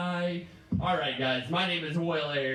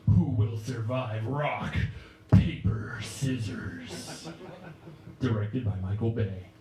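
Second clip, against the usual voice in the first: deep, dramatic trailer narrator; movie trailer voice; 5 semitones lower than usual. Pretend. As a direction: announce it like an epic blockbuster trailer.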